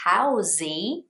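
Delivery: "housey" slowly, as how plus zee; two-syllable housey. Shown as a demonstration. Teacher imagines the wrong word, "housey" in two syllables. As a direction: add a rising zee to make "housey". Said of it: "it would be two syllables".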